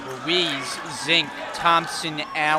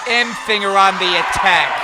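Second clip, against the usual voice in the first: higher, louder drive call; excited call; 5 semitones higher, 9 dB louder than usual. Real, not acted. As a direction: push the call with the attack.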